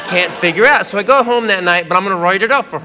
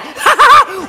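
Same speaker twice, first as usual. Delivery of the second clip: loud high cackle, hammed-up; mock girlfriend laughing helplessly; imitated laughing fit; 5 dB louder than usual. Girlfriend keeps laughing at him.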